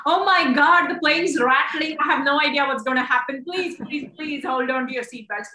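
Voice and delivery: a pilot losing it over the intercom; panicky pilot. Retold or imitated. Pilot imitating a panic over turbulence, alarmed and fast. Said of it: "You don't hear him freaking out".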